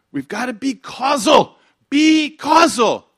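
loud, high, drawn-out rallying cry; preaching exhortation. Retold or imitated. Preacher urging a city crowd to be causal, loud exhortation. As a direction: stretch out "causal" like a slogan. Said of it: "I was saying".

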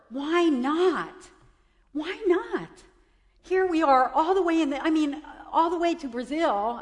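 high, pleading appeal to God; retold anguished plea. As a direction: plead upward in frustrated sorrow. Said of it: "I was really sad. And I was saying to myself".

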